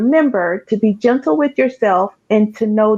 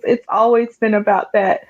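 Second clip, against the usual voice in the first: ordinary talk, nothing marked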